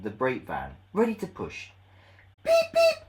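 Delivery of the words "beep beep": eager Edward. Acted.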